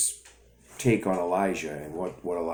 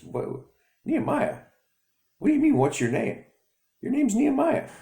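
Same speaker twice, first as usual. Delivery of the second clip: high, astonished mother; baffled mom voice; surprised mom; 5 dB louder than usual. Acted.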